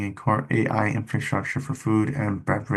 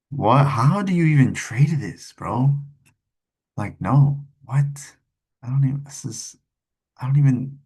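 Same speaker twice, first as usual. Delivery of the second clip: incredulous; 5 semitones higher, 5 dB louder than usual. Real, not acted. Baffled by a stock chart.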